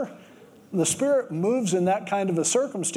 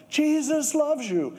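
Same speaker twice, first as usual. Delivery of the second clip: higher, drawn-out shout of the words; mock yell; 9 semitones higher, slower than usual. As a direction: call it out slowly into a big mailbox. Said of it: "stuck his head in the mailbox and yelled".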